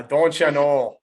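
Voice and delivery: slow, drawn-out mock Minnesota accent. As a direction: tease with a folksy Minnesota accent. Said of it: "that's more like Minneapolis, like M-, Minnesota".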